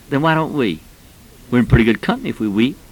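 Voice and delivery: normal talking voice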